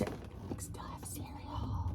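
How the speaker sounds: slow hushed whisper; whispering happily that cereal is still allowed; delighted whisper. Real, not acted. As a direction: breathe it like a secret treat.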